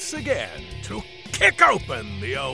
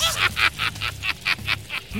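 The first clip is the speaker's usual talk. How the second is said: evil cackle; 4 dB quieter than usual. Acted. Spooky host intro cackle.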